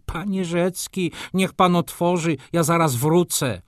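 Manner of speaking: high, strained calling voice; stifled plea. A narrator's reading. Gutmorgen locked in the shop at night, pleading. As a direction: plead to be let out, pitched well up. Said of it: "stłumiony głos Gutmorgena".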